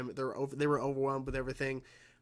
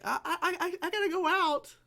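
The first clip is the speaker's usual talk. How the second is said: put-on high, stuttering squeak of a caught kid; nervous stammering excuse. Ben making excuses to his parents, high nervous stammer. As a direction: fumble it out like a busted teenager.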